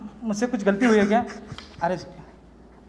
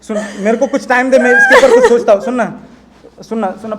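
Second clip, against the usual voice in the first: panicked; 2 semitones higher, 12 dB louder than usual. Acted. Panicked boyfriend tries to calm her.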